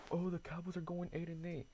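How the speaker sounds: mocking hater. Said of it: "Hit me with the".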